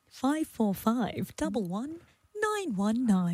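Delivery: slow, soft English lady's voice; put-on English accent. Host gives phone number teasingly, slow sultry English accent. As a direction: draw out each word seductively, English accent. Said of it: "a lady with a lady's English accent".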